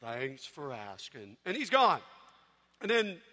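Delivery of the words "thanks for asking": slow low mopey drawl; low, slow, dejected donkey voice; Eeyore gloom. Retold or imitated. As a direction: sigh it out like Eeyore. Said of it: "Eeyore's like".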